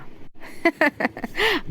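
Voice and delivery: soft, high laugh; amused giggle at a child's cheek; light acted laughter. Women laughing over the child's mischief.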